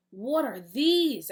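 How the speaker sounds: loud, slow, high wondering question; puzzled hero. Acted. Eagle Boy eyeing the strange lumps, slow and suspicious. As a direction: draw out your suspicion of the plate. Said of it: "asked Eagle Boy".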